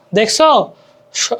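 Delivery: ordinary talk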